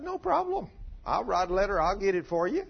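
high, breezy scheming wife. Retold or imitated. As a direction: toss it off as if murder is easy. Voices scheming wife in retelling.

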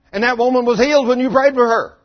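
high and loud, stunned; a hardened coworker blurting his shock; amazed outburst. Retold or imitated. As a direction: insist on it in disbelief.

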